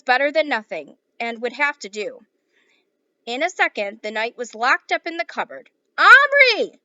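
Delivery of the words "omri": high, loud call of a name; mother calling. Acted. Mother calling her son to hurry, loud high shout. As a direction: call him from downstairs.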